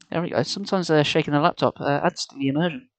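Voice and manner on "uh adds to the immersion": usual speaking voice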